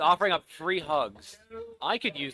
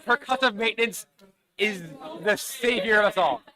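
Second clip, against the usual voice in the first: excited; 7 semitones higher, 6 dB louder than usual. Real, not acted. Triumphant laughing declaration.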